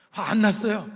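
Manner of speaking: loud, mock-angry insistence; angry denial. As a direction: act out someone loudly denying their obvious anger. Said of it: "화 난 거예요, 안 난 거예요?".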